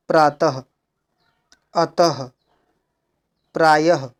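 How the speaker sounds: प्रातः, अतः, प्रायः each ending in a sounded "ha"; visarga like ह. Shown as a demonstration. Teacher reading visarga examples, प्रातः, अतः, प्रायः ending in ह. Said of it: "इसका उच्चारण ह के समान".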